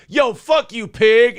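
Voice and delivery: defiant yell. Retold or imitated. Resisting suspect yelling at the police, loud. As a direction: spit it at the cop.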